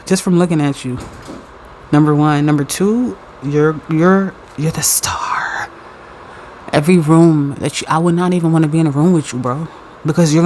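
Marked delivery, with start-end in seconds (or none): 4.6-5.7 slow hushed whisper; slow awed whisper. Real, not acted. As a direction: breathe it like a secret. Awed reveal.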